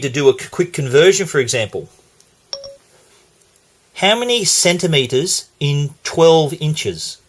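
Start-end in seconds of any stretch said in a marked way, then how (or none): 3.9-7.2 slow, careful question to a voice assistant; measured, spaced-out query for a machine; deliberate voice query. Real, not acted. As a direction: ask the phone slowly, phrase by phrase.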